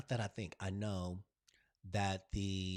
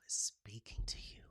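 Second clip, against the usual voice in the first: whisper; 2 semitones lower, 7 dB quieter than usual. Real, not acted. Intimate spiritual message, whispered.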